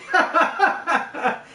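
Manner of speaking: loud, hammed-up belly laugh rising high; exaggerated, booming ha-ha-ha; imitated deep laugh. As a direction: mimic a friend's big hearty laugh. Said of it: "deep laugh".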